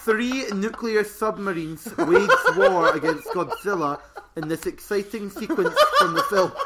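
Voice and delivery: loud, drawn-out dramatic card reading; hammed-up trailer voice. Host reads a monster trading card to co-host, hammed-up trailer voice. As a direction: boom it out like an over-the-top film trailer.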